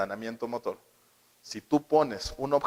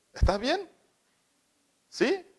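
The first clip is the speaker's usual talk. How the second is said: quick check-in; 13 semitones higher, 3 dB louder, slower than usual. Retold or imitated. Adult checks on fallen child.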